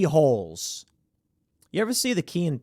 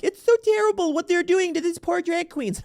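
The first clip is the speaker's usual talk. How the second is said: much higher mocking whiny voice; mocking leftist; 14 semitones higher than usual. Host mocking leftists' reaction to drag queen attacks, high whiny parody. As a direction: parody a pearl-clutching leftist. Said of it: "leftists are going like".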